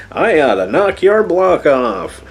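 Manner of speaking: high, slow Big Bird voice in a cartoon rage; cartoonish fury. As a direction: play it like an angry cartoon bird threatening a fight. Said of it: "Big Bird gets all pissed off".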